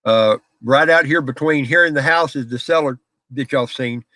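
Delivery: ordinary delivery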